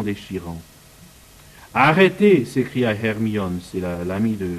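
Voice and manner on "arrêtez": high, loud cry of Stop; piercing cry. A narrator's reading. Hermione crie au professeur de s'arrêter, d'une voix perçante. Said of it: "d'une voix perçante".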